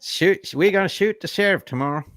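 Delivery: hammed-up Texan sheriff; Texan accent. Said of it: "Southern Texans".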